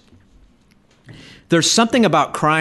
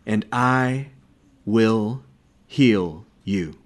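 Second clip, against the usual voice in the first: slow, lower, word by word with long pauses; solemn divine promise; 5 semitones lower, slower than usual. Acted. The Lord answering Hezekiah's prayer, slow solemn promise. Preacher voices the Lord's promise.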